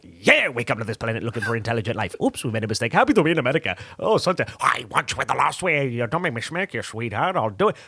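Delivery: flick through his film voices one after another. Retold or imitated. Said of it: "doing all these different voices".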